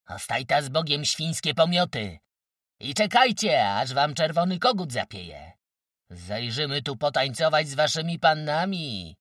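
high, loud mocking yells; jeering shouts. A narrator's reading. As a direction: hurl parting jeers at the enemy. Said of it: "szydliwiej zasie krzykając".

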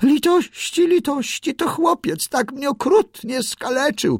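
very high, pleading bird squeak; squealing for mercy. A narrator's reading. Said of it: "piszczała ptaszyna".

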